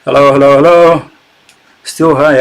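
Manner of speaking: ordinary talk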